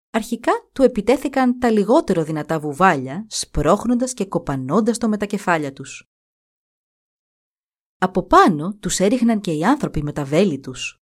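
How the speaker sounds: usual speaking voice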